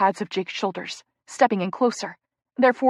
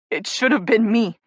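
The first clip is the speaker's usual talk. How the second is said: crying; 4 dB louder than usual. Acted. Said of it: "Jake cries".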